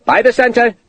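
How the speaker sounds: sergeant's shout. A narrator's reading. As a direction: call the drill order. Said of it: "the sergeant shouted".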